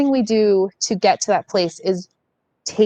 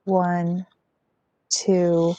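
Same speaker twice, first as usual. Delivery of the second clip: guided breathing. Acted. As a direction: ease the group into calm breathing. Leads a guided breathing exercise.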